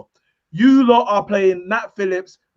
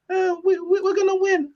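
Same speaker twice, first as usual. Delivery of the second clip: much higher, timid mock Liverpool fan; no bass in the voice; 11 semitones higher, 4 dB quieter than usual. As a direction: squeak it out like you don't believe it. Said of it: "there's no bass in their voice".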